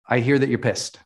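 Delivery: mirror it back calmly and evenly; calm reflecting. Shown as a demonstration. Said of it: "coach demos a calm reflecting reply".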